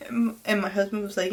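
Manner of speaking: usual speaking voice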